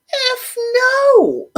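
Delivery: high, loud imitated outburst; emphatic mock refusal. Nick asked about a name tattoo, mock shouted refusal.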